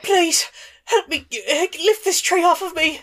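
high, loud desperate plea; pleading old elf woman. Acted. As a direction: beg them to lift the tree. Elf begs again.

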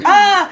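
high, loud shocked "ah"; exaggerated outraged gasp; mock crowd gasp. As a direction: imitate a scandalized crowd crying out. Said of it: "the crowd's like".

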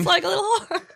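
high, tearful Australian actress impression; Nicole Kidman. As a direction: whimper it in a put-on Australian accent. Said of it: "I've also got Nicole Kidman".

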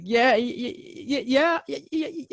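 high, loud nervous stutter. Retold or imitated. Starstruck fan answering the singer, high stammering yes. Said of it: "I was stuttering like Adam Sandler".

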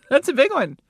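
laughing. Real, not acted. Laughs at the royal news.

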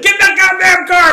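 high laughing imitation of her yell; laughing mock yell. Wife ordering him into the car, mock yell while laughing. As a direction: mimic the wife yelling, cracking up. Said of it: "And she's like".

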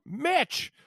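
very high-pitched mock holler; imitated fan shout. Fan calling to the quarterback at the snap, imitated high shout. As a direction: yell it like a fan in the stands, voice way up high. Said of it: "he would just shout".

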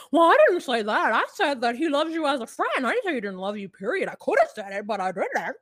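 lightly mocking Jenny's protest. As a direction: play Jenny huffy and defensive. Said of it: "And Jenny's like".

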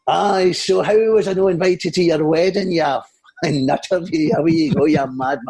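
cheeky Scottish comic, mock indignant; Billy Connolly. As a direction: put on the comedian's teasing rant. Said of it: "as Billy Connolly and I went".

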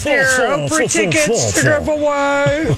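bright on-air host welcome; radio host. Acted. Host greets listeners over music.

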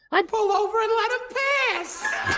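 high, squeaky puppet voice, slow at the end; a cheeky ventriloquist dummy. Acted. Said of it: "And the dummy tells him".

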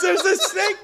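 mock-urgent warning through laughter; imitating alarm. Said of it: "He goes".

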